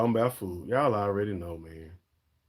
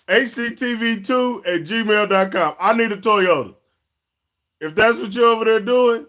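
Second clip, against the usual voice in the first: much higher, louder laughing appeal; hyped joking request for a truck; excited plea; 10 semitones higher, 6 dB louder than usual. Real, not acted. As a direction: beg the dealer playfully. Excited plea to car dealer.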